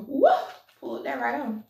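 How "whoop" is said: a sudden loud high whoop of delight; excited whoop. Real, not acted. Eating crabs on camera, a delighted whoop. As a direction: yelp with joy at the crab.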